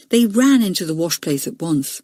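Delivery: ordinary delivery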